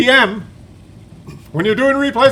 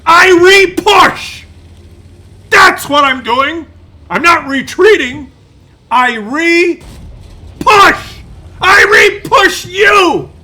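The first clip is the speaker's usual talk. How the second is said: defiant shout; 8 semitones higher, 6 dB louder than usual. Pretend. The mouse tank defiantly yells at its attackers. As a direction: roar it in defiance.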